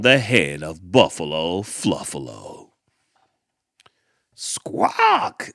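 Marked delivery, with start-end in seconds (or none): 4.3-5.5 very high, raspy squawk of a crow. Acted. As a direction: squawk like a mocking bird. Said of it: "said the crow".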